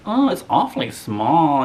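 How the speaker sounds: slow, prissy mock-posh voice; imitating a snob looking down on the house; snooty rich cousin. Snobby rich cousin quote.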